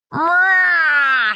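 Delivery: loud high hissing cat spit; a cat's spitting snarl. A narrator's reading. Cat Honey spits as she lands on the dog. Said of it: "spits Honey".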